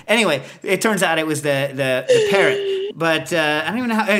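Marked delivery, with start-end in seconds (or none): none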